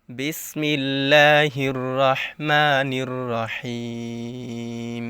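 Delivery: slow, drawn-out recitation; Quran recitation. Acted. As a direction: chant it slowly and devoutly. Recites Bismillah.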